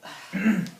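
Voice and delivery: loud, high throat clear for attention; pointed throat clear. Acted. Host nudging the distracted guest, a loud throat clear. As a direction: clear your throat to get noticed.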